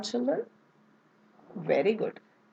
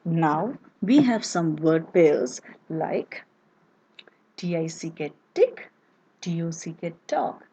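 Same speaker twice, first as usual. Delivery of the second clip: ordinary talk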